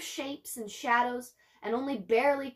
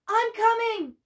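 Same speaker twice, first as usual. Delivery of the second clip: much higher, loud call; called again; 10 semitones higher, 6 dB louder than usual. A narrator's reading. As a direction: shout back to the trapped voice. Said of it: "Min Lee called again".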